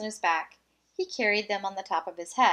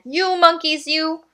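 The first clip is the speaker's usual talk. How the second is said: higher, louder angry demand; angry peddler; 5 semitones higher, 7 dB louder than usual. Acted. Angry peddler demanding his caps from the monkeys, loud. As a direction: shake your fists as you say it. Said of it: "This made the peddler angry".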